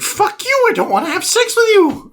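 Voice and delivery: shrill mock outrage. Repairman shoving the aunt away, high and indignant. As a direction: squeal the refusal way up high.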